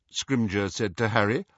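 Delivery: plain reading voice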